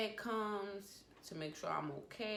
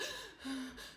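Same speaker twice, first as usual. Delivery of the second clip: quick, high gasping breaths; imitated hyperventilating; 8 semitones higher, 5 dB quieter than usual. Daughter on the phone to mom, imitating her hyperventilating. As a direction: act out hyperventilating in short bursts. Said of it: "I'm all hyperventilating".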